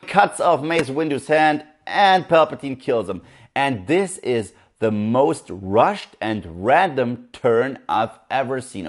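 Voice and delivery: ordinary talk, nothing marked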